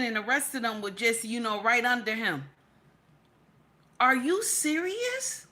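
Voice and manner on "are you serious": much higher, slow, drawn-out disbelief; stunned disbelief. Real, not acted. Streamer reacting to the children's story in slow, high disbelief. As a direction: stretch it out, not believing your ears.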